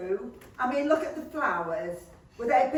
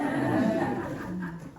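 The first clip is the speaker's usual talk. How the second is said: giggly laugh; 3 semitones lower than usual. Acted. Wife's giggle after the French.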